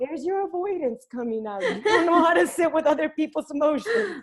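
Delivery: high, laughing call-out; laughing tease. Retold or imitated. Daughter laughing as she calls out her mom's avoidance. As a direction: point it out through giggles.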